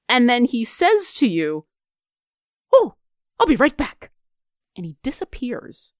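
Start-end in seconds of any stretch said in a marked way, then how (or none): 2.7-4.1 a hint of a posh British owl; higher, lightly voiced owl companion; pompous little owl. Owl familiar briefly excusing himself to his wizard, lightly pompous. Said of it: "The weird little pompous voice".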